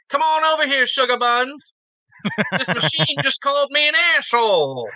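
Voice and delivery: high, badly faked Southern accent; Stephen King. Imitating an author's movie cameo, bad Southern accent. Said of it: "trying to do a Southern accent".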